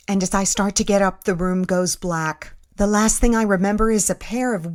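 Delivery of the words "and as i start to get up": usual speaking voice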